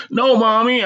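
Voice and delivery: put-on high-pitched child's plea; pleading. A narrator's reading. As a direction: beg mother in a little boy's voice. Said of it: "I pleaded".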